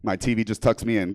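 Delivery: ordinary talk, nothing marked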